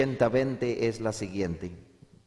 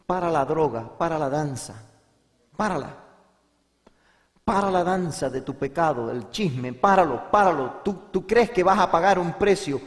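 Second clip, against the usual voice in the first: raised, commanding preacher's plea; urgent exhortation; 5 semitones higher, 5 dB louder than usual. Real, not acted. Preacher urges the young to stop, urgent and raised. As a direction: hammer each command out, high and forceful.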